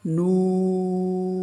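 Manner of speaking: নুন held very long; long chanted নুন; drawn-out nun. Shown as a demonstration. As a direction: stretch নুন four alif lengths. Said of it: "চার আলিফ টান হবে".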